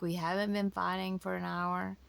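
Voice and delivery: ordinary talk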